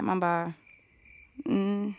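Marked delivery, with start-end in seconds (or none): 1.5-1.9 acted-out hesitant, quiet, drawn-out okay; doubtful reaction. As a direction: mimic a soft, halting, unconvinced reply. Acting out awkward reply to friend.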